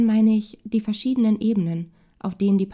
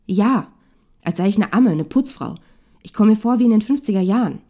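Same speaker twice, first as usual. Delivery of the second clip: loud, emphatic indignation; upset and angry; 2 semitones higher, 7 dB louder than usual. A narrator's reading. Wife protesting her role at home, properly worked up. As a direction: spit out the grievance, punching each image. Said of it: "Jule ist richtig aufgebracht".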